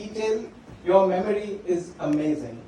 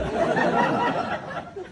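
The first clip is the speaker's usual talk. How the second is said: real laugh. Real, not acted. Laughing after the swear story.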